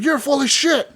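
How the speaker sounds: high-pitched mock outrage; indignant heckler. Audience member calls the magician a fake, indignant. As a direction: heckle from the front row, imitating a doubter. Front-row heckler denounces the act.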